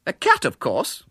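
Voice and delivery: a mildly sharp prim reply; losing patience a little; rather sharply. A narrator's reading. Miss Milton to the boy, mildly sharp. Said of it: "said Miss Milton rather sharply".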